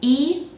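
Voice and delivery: isolated, clearly held EE; long E vowel. Shown as a demonstration. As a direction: say "e" as in eat. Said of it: "take the two sounds".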